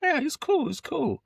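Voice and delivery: high, easy "he's cool"; airy high reassurance; breezy reassurance. Retold or imitated. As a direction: brush off the worry, lightly. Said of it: "And I was like".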